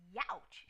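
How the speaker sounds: sharp high yelp of pain; cried youch. A narrator's reading. Goldilocks burns her tongue, sharp pained yelp. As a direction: yelp as the hot porridge burns. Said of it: "she cried".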